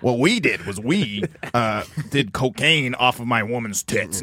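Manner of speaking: low, slow, lazy rocker drone; imitating Jim Florentine. Retelling Jim Florentine's eighties story, low slow impression. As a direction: drag it out like a burnt-out metal guy. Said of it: "Jim Florentine's like".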